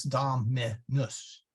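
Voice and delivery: "dominus" in spaced syllables, ending short -nuss; short final U. Shown as a demonstration. Teacher correcting a common slip, short U. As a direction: clip the U in "dominus", do-mi-nus, never rhyming with noose. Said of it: "short U".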